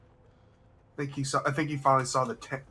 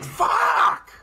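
sudden high shouted curse; 8 dB louder than usual. Real, not acted. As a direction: yell in shock. Teammate killed suddenly.

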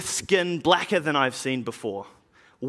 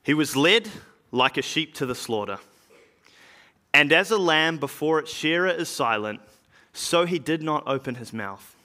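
plain reading voice

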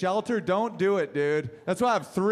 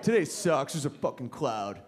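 mopey low voice; 5 semitones lower than usual. Retold or imitated. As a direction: grumble it, deflated. Mopey human complaint.

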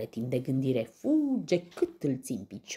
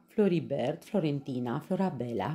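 plain reading voice

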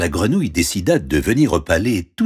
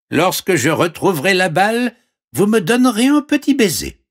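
very high frog voice; squeaky-high frog offering help; talking frog; 12 semitones higher, 4 dB louder than usual. Acted. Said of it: "répondit la grenouille". (voix de grenouille très aiguë)

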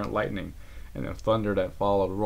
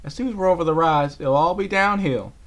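higher, louder reassurance; encouraging; 9 semitones higher, 8 dB louder than usual. Acted. Applejack encourages tired Rarity. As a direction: coax a tired friend on.

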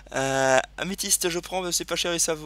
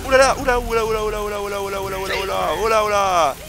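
panicked oulala; 4 semitones higher, 5 dB louder than usual. Real, not acted. Panicking under dragon attack.

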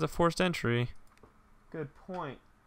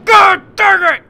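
much higher, much louder outburst; frightened shout; 20 dB louder, slower than usual. Real, not acted. Player startled by a jump scare, shouting. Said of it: "Don't fucking scare me".

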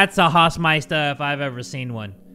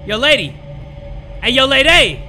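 calling out; 6 semitones higher, 6 dB louder than usual. Real, not acted. Calling to a figure.

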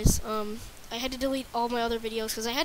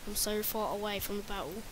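lightly put-on British accent; British accent, a bit lower and softer; 3 semitones lower, 7 dB quieter than usual. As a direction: slip into a playful British voice. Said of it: "I can do a British voice really well".

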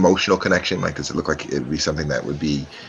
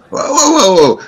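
high, loud startled protest; panicked whoa; 9 dB louder than usual. Acted. Goblin thief panicking as the woman calls guards. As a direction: back off fast in panic.

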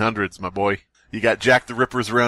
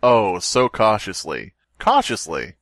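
slow dramatic reading; 5 semitones lower, slower than usual. Acted. Reading Poe slowly.

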